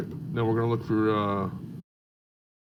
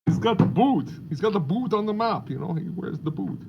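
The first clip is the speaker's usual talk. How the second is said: put-on European accent; 5 semitones higher than usual. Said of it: "That's my European accent".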